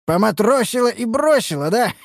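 high, melodramatic mock reproach; teasing master. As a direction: play the jilted lover for laughs. Master teases elf about their night.

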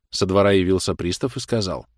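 usual speaking voice

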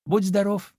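high, cheery piglet goodbye; friendly farewell. A narrator's reading. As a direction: call a friendly goodbye, voice lifted. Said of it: "приветливо помахал ему Чуня". (приветливо, высоким голоском)

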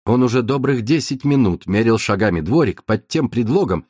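ordinary delivery, nothing marked

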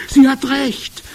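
high, loud agreement; calling out. A narrator's reading. Second witch backs up the first, calling out. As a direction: cry it out in agreement. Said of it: "rief eine zweite Stimme".

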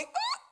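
un "Ah!" acutissimo di sorpresa; acuto grido di scoperta. Acted. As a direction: scatta su come chi ha capito tutto. Il grano ha un'illuminazione. (un "Ah!" stridulo di stupore improvviso)

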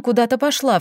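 plain reading voice

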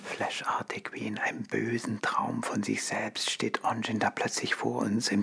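hushed unvoiced whisper. Narrator whispering to the listener, hushed. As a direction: breathe the words, no voice.

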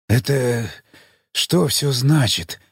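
parched, croaking voice; hoarse croak. Acted. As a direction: rasp it out with a dry throat. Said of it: "прохрипел Юзеф".

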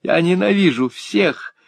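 put-on enraged girl wailing that she hates everyone; sobbing with rage. A narrator's reading. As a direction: spit it out in a fit of tearful spite. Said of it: "разрыдалась от злости".

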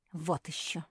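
mildly huffy refusal; a touch of scornful snorting; dismissive snort. A narrator's reading. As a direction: brush it off with a huff. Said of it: "фыркнула Куки".

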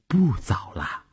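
soft, whispered gentle reply; intimate whisper. A narrator's reading. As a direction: whisper back to a lover in bed. Lover answers softly in bed. (轻声耳语)

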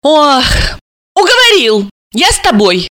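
sighed, giving in; sighing consent. A narrator's reading. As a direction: sigh up high, then give in. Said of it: "вздохнула Алиса".

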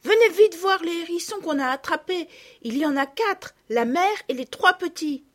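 high, loud, eager; excited calling. A narrator's reading. Girl urging friends to see the hedgehogs, eager and high. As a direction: urge them to come see. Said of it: "leur cria-t-elle".

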